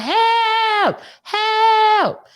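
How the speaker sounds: high, loud mock cry of help; hollering for help. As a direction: holler it out like she's in withdrawal. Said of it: "she started hollering".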